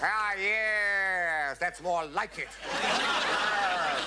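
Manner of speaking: high, drawn-out relish; hammed-up satisfaction, loud and high; gushing approval. Officer praising the troupe's second try, gushing.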